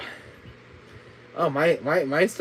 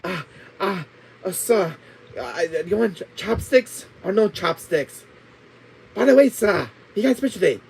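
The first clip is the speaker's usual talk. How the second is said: put-on Chinese accent; 3 semitones higher than usual. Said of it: "do a Chinese accent".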